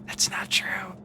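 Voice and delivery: unvoiced whisper; whispered aside. Taking back the stalker joke to chat, whispered. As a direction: whisper it as a quick correction.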